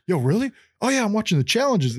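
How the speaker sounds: high, eager voice of the excited fan. Retold or imitated. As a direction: play him thrilled to meet you. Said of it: "And then he's like".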